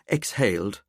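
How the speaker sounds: ordinary delivery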